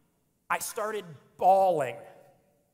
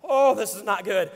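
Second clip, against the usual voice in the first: winded groan; 8 dB louder than usual. Retold or imitated.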